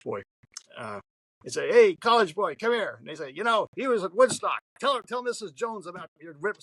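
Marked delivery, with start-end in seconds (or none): 1.7-3.0 higher, louder shout across the store; calling out. Retold or imitated. Store regulars call College Boy over, loud and high. 3.3-6.6 higher, hearty teasing voice; teasing store guys. Retold or imitated. Store guys urge him to tell Missus Jones, teasing and higher.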